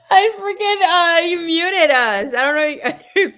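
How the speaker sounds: half-crying with laughter, slow; laughing hard. Real, not acted. Laughing while talking, loud.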